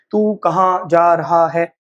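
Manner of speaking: "तू कहाँ जा रहा है" slowly, every syllable separate; stilted, slow, over-articulated "तू कहाँ जा रहा है"; unnatural word-by-word. Shown as a demonstration. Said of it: "Hindi भी नहीं बोलते हम ऐसे".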